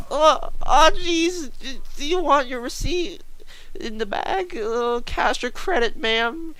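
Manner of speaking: high, stammering mock-nervous voice; nervous cashier. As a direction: squeak it out hesitantly, acting intimidated. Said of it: "so nervous as shit".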